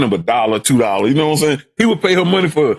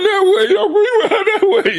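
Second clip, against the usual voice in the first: put-on childlike voice. Boy pointing after bike thief.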